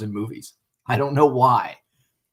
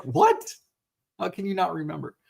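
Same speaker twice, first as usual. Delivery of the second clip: higher-pitched reenacted disbelief; mock incredulity; 6 semitones higher than usual. Husband questioning wife who forgot the book, mock incredulous. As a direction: play up being baffled, pitch lifted. Retold disbelief at wife's forgetting.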